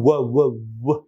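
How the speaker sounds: long "oo" gliding into repeated "ওয়া"; rounded-lip sound drill. Shown as a demonstration. Teacher drilling the w for learners, repeated "ওয়া". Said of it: "মুখটা গোল হবে".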